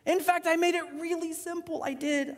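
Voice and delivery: high-pitched, protesting voice of God; exasperated God. Acted. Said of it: "God's up there going".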